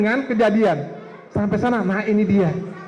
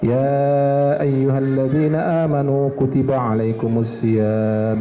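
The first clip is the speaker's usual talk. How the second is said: Quran recitation; 6 semitones lower than usual. Shown as a demonstration. Ustadz demonstrating Quran recitation in a low chant. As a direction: recite the verses in a long sung tilawah.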